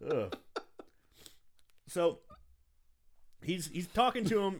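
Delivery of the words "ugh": ordinary talk, nothing marked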